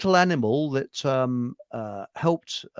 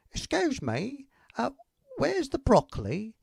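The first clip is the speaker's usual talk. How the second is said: higher, lightly put-on lady voice; a hint of a dotty old customer asking again; woman shopper; 8 semitones higher, 4 dB quieter than usual. Joke teller voicing the woman back at the shelves, mock lady. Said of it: "she comes back again and she says".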